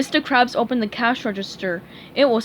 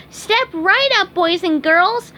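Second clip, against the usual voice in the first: high, slow, eager cartoon sponge; SpongeBob voice; 8 semitones higher, 6 dB louder, slower than usual. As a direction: call out like a cheery head elf.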